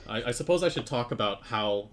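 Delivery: ordinary talk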